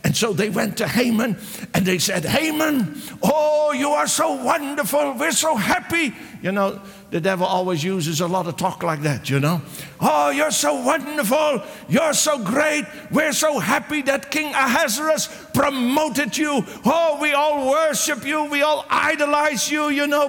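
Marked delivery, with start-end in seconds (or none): 2.3-6.1 mock flattery. Flatterers praising Haman. 10.0-20.3 mock flattery. Flatterers praising Haman again.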